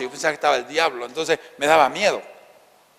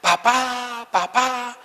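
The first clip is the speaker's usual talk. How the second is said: put-on scared child calling; 9 semitones higher, much slower than usual. Boy in the blackout crying out for his father, high frightened calls. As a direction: call out for dad like a terrified little boy. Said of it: "Y yo comenzaba a gritar".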